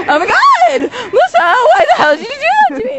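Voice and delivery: high, loud mock outrage; hammed-up outraged friend. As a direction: shriek at Lisa in fake fury. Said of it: "Salima was like".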